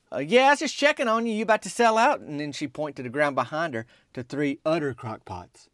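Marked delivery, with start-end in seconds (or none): 0.1-2.2 casual question pitched well up; higher-pitched reply. Retold or imitated. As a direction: act out a high, just-checking reply. His awkward reply at the window.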